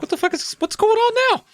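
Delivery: very high squeaky mock panic; shrill high mock alarm. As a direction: freak out in a cartoon voice. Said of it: "I'm like".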